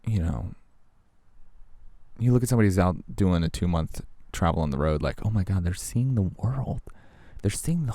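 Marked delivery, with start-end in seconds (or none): 5.2-7.9 awed onlooker. Retold or imitated.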